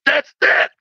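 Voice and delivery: very high-pitched exclamation of revelation; comic high eureka cry; Charlie Brown. Retold or imitated. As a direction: cry out as if finally diagnosed. Charlie Brown's revelation, acted as punchline.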